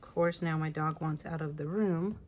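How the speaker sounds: normal talking voice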